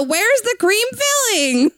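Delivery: high, slow quoted ad line; quoting the ad. Retold or imitated. Friend quoting the old Twinkies commercial in a high, slow voice. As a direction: pitch "Where's the cream filling?" up high and draw it out. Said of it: "It's, it's the".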